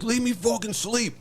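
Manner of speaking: high, slow mock complaint; woken man imitation. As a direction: imitate a sleeper wanting to be left alone. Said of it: "He's like".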